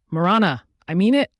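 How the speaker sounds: an angry threat, pitch climbing at the end; angry growl. A narrator's reading. Neo pounding on the toilet door, angry warning. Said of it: "he growls as he pounds".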